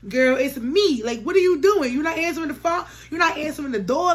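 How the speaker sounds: retold exasperated calling; insistent calling. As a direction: urge a friend to open up. Calling through a closed door.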